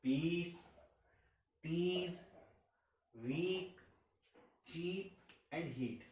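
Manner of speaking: long ee in पीस and वीक; stretched ee. Shown as a demonstration. Teacher demonstrating stretched ee sound. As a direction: draw out the ee in चीप and हीट. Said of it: "इसे आप stretch करते हुए बोलते हैं".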